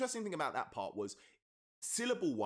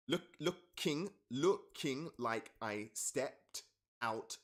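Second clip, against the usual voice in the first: slow syllable count; 3 semitones higher, much slower than usual. Shown as a demonstration. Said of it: "not doing this right".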